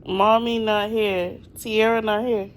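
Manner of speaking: loud teasing playful voice; pretend mommy voice. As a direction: call out playfully to the child. Teasing child, pretending absent.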